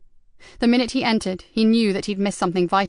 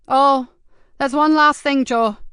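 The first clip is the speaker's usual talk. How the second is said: higher, louder cheeky lad; lightly voiced streetwise teenager; teen lad voice; 5 semitones higher, 6 dB louder, slower than usual. Mickey's voice again.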